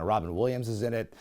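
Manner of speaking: usual speaking voice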